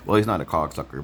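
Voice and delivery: ordinary talk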